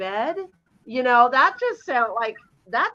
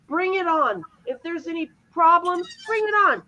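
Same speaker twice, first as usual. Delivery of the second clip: excited rallying cry; 7 semitones higher than usual. Real, not acted. Cheering the hosts on, rising.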